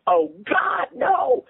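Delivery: very high anguished scream. A narrator's reading. Father seeing his child is a boy, screaming. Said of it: "he screamed".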